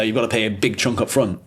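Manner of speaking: usual speaking voice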